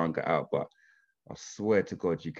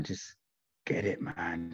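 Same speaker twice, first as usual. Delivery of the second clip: whispered urging; hushed advice; 3 semitones lower, 8 dB quieter, much slower than usual. Real, not acted. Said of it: "advice delivered".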